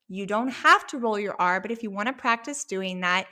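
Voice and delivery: ordinary delivery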